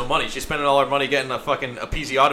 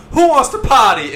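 mock party woman; 9 semitones higher, 9 dB louder than usual. Voices the woman in a joke.

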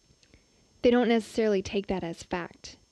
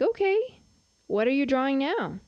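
high, surprised "okay"; surprised mom; 5 semitones higher than usual. Retold or imitated. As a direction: pitch the okay way up. Mother's surprised question.

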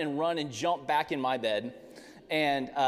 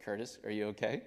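softer, lower voiced mom; mom's hushed question; 5 semitones lower, 8 dB quieter than usual. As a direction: quietly check on him after the uproar. Imitates mom in the quieted house.

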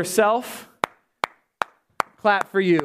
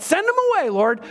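mock command; 9 semitones higher than usual. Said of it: "sometimes we try to do that".

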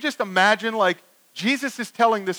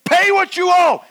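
loud, high, furious shout; choking demand; 12 semitones higher, 8 dB louder than usual. Acted. Servant choking the man who owes him, furious shout. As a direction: shout it like a creditor grabbing his debtor. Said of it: "begins to choke him, saying".

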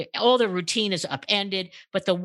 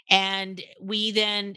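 ordinary talk, nothing marked

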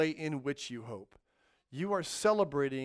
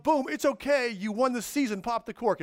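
excited reassurance; 7 semitones higher, 6 dB louder than usual. Retold or imitated. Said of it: "They show up".

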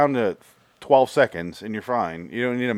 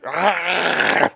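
short high cry in a put-on voice; mock yell; 7 semitones higher than usual. Acting out a Baldwin brother, a mock yell. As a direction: yelp it out in character.

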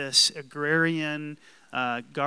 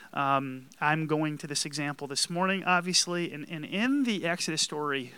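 usual speaking voice

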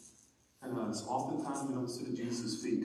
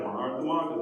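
mock scolding; 4 dB louder than usual. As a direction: imitate someone sighing over a repeat offender. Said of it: "the way I usually hear it is like".

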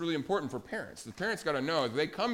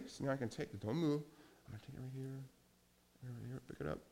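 careful hush; 2 semitones lower, 11 dB quieter, much faster than usual. Acted. Carefully removing speck from Jimmy's eye.

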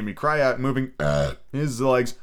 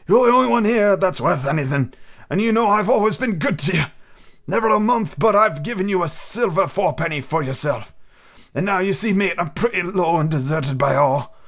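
put-on raised, rough old seaman wheedling for rum; a sick old sailor coaxing the boy; higher, louder pirate voice begging; old pirate captain; 8 semitones higher, 3 dB louder than usual. Narrator voicing the captain.

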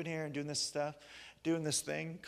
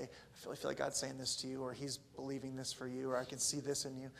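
humble soft; 3 semitones lower, 8 dB quieter than usual. Retold or imitated. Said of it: "humbly I say".

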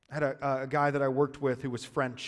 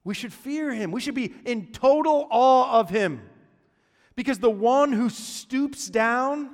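higher, louder, fervent preacher voice; fervent preaching; 9 semitones higher, 8 dB louder than usual. Acted. Pastor urging congregation to fear God, fervent preaching. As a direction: preach it with full conviction.

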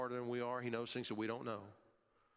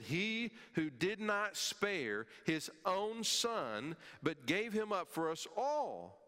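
slow, raised, higher preaching voice; preaching at full force; 8 semitones higher, 3 dB louder, slower than usual. Acted. Pastor proclaiming Romans to the congregation, slow and raised. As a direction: proclaim each word slowly.

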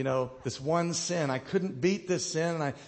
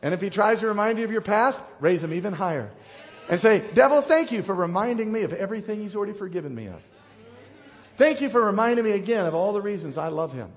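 loud, raised exhortation to the congregation; full-force preaching; 4 semitones higher, 6 dB louder than usual. Real, not acted. Preacher telling believers to resist the devil, loud and defiant. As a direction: preach it out boldly, high and loud.

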